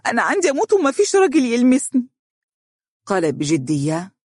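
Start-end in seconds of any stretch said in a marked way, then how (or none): 0.1-2.1 put-on higher, tearful young woman's protest; broken voice. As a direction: push it out through tears, near breaking. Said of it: "وقالت بصوت متقطع". (بصوت متقطع باكٍ ومرتفع)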